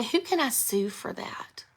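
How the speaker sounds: slow, soft joking whisper; whispering a cheeky joke to viewers. Real, not acted. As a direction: whisper it like a naughty secret.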